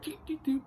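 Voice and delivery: high squeaky mock doot doot doot; mocking doot noises. Mocking the dazed opponent, a squeaky cartoon doot doot doot. The opponent fumbling for his glasses.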